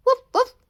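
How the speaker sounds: high, loud yappy woof woof; puppy bark. Acted. The puppy Milo barks happily at Ollie, a loud high yap. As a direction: bark like an excited little puppy.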